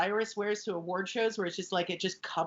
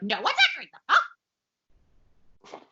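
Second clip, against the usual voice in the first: imitated freak-out, jumping up at the end; hammed-up panicked outburst; mock meltdown; 5 semitones higher, 4 dB louder, faster than usual. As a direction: act out a comic meltdown. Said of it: "she has a meltdown".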